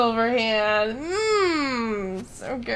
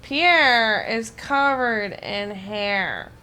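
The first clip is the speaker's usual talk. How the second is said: slow drawn out; 2 semitones lower, 4 dB louder, much slower than usual. Pretend. Drawn out mock line.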